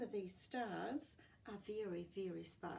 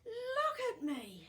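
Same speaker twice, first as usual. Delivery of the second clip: high, loud and slow amazed cry; breathless wonder at himself; gasping in amazement; 9 dB louder, slower than usual. Acted. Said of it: "he gasped".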